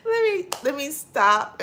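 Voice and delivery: high laugh breaking in; can barely speak for laughing. Real, not acted. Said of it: "'cause I'm laughing".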